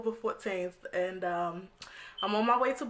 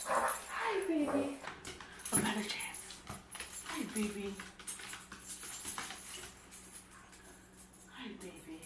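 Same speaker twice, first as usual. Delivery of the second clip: hushed, much softer cooing; 14 dB quieter than usual. Real, not acted. Cooing to pet quietly.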